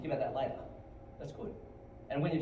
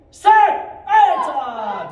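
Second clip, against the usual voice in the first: high, explosive counting yell; drill command shout; 13 semitones higher, 19 dB louder, much slower than usual. Real, not acted. Instructor calling kicks for students, shouting. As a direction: bellow the count to drive the class.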